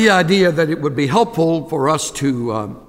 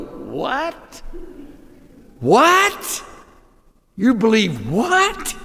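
higher, rising disbelief; can't believe what they just heard; incredulous; 6 semitones higher, much slower than usual. Pretend. Sophisticated group reacting to the cross, in shocked high disbelief.

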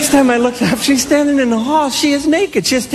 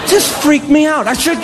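ordinary talk, nothing marked

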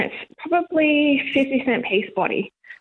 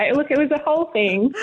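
normal talking voice

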